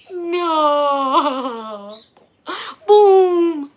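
loud, high boom with a laugh; booming game voice for a toddler; playful boom. Acted. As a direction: make the boom a fun surprise for the baby. Baby game.

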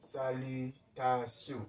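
slow, careful Salutation in French, sa-lu-ta-sion; teacher models Salutation slowly; model word. Shown as a demonstration.

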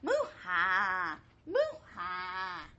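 low mooing laugh; laughing cow. Acted. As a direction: cows chuckling awake. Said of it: "woke up with a laugh".

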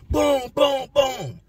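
high punchy boom boom imitation; door kicks. Inmate imitating kicking his cell door. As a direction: mimic heavy kicks on metal.

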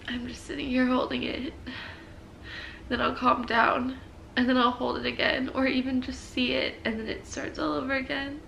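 whispering through tears; tearful whisper. Real, not acted. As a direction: hush it, still crying softly. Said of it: "I'm gonna cry again".

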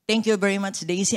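ordinary delivery

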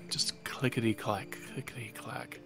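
hushed, eerie whisper; whispered sound effect. Acted. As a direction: whisper it like distant claws. DM imitating claw sounds.

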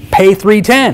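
high, loud punchline on the price; emphatic punchline. Retold or imitated. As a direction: land the price hard and high. Punchline of retold advice.

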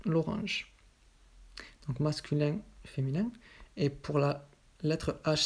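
ordinary talk, nothing marked

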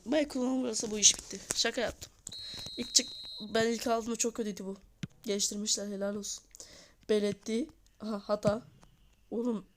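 ordinary delivery

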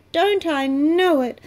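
high, loud fed-up little caboose; a small caboose complaining with a groan; weary little caboose. A narrator's reading. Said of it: "sighed the little red caboose".

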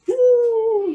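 high, loud, sustained hoot; owl hoot. Shown as a demonstration. Coach inventing animal noises for the class, loud owl hoot. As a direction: hold one long owl-like "woo". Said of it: "that was a, an owl".

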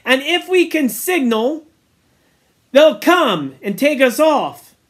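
high, loud rallying speech; rally the boys firmly; rousing. Acted. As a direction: Ralph laying down rules. Ralph addressing the assembly.

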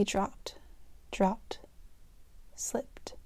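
breathless whisper trailing off; fading whisper. A narrator's reading. Narrating her eyes closing, fading to a whisper. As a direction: let it slip away into a whisper.

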